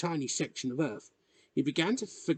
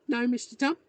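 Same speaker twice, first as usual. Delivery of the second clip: put-on high-pitched little boy; small boy politely answering his elder; young boy's voice; 11 semitones higher than usual. Timid boy answers the old man.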